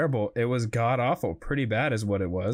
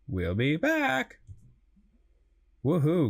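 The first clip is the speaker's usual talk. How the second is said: ordinary talk, nothing marked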